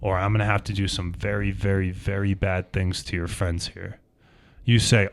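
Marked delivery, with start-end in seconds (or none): 0.0-4.0 quiet threat. Acted. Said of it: "The individual says".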